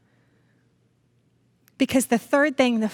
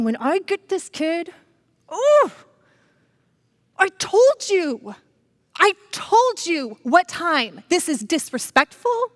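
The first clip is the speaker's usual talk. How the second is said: higher, louder, slow furious scolding; angry rant; 6 semitones higher, 3 dB louder, slower than usual. Retold or imitated. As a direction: bite out each word like an angry parent. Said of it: "So mad".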